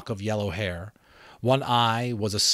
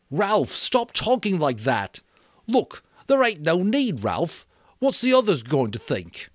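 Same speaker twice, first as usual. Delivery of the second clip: put-on higher, louder, urgent boy; pleading Piggy; 9 semitones higher, 6 dB louder than usual. Piggy begging Ralph to stop talking that way.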